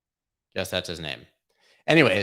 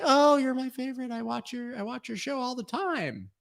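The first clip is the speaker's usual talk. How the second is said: much higher, breathless fangirl squeal; gushing starstruck fan. Pretend. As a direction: backpedal into over-the-top adoration. Voicing flustered fan in prank.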